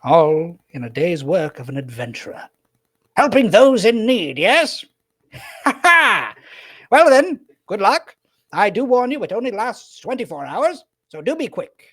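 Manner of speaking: high, loud, laughing wizard boast; hammed-up heroic pride; boastful old wizard. Wizard bidding the pair farewell, high and boastful. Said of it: "He goes".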